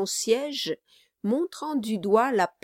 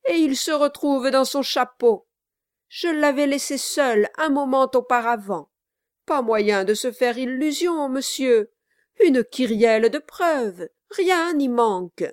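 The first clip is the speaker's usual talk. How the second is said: higher, louder pious complaint; aggrieved lawyer; 5 semitones higher, 5 dB louder than usual. Acted. Brass details the evidence to Quilp, aggrieved. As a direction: list the proofs with false sorrow.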